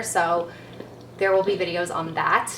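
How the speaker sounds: ordinary talk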